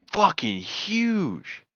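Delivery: HUGE stretched long and high; drawn-out boast. Retold or imitated. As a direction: brag slowly, stretching out huge. Joke Texan bragging about airports.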